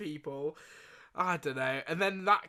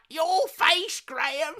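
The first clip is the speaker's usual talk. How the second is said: higher, louder teasing cackle-line; gotcha taunt; 11 semitones higher, 5 dB louder, much slower than usual. Retold or imitated. Nan sitting up to mock her grandson, teasing. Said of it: "she sits up and she's like".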